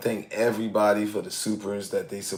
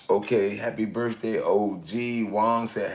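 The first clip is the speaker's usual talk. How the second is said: normal talking voice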